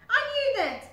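high, loud flustered retort; embarrassed crocodile. Acted.

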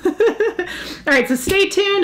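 high, giggly wrap-up; laughing sign-off. Real, not acted. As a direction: laugh it off cheerfully.

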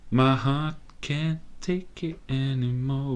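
milk the line like a weary drama; dramatic repeat. Acted. Player repeating his line, slow and mock-dramatic. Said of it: "mainly because my heart can't take it anymore".

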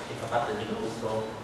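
usual speaking voice

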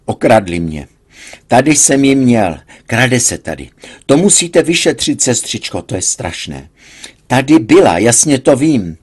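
patient bursting out at the nurse about a theft; agitated outburst. A narrator's reading. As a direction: blurt it out, robbed and frantic. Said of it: "postavil se a vyhrkl".

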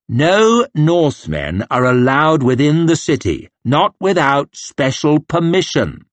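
put-on slow and condescending, as to a child; slow, as if to children. Officer talking down to the northmen, slowly. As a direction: spell it out slowly to a dim child. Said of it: "spoke real slow, as if to children".

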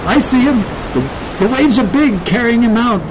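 high, urgent voice; exclaiming kid. A narrator's reading. Kid spots Dad in big waves, exclaiming. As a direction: cry it out in alarm and excitement. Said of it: "the kid exclaimed".

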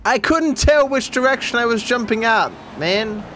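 complaining excuse; whiny excuse. Real, not acted. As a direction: plead your case. Excusing failure.

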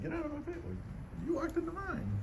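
ordinary talk

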